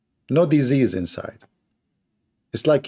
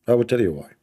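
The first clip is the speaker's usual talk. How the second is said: usual speaking voice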